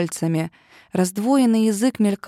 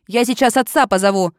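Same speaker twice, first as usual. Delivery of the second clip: loud threat; 6 dB louder than usual. A narrator's reading. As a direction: shout it as a warning. Оливия угрожает похитителю. (громкая угроза)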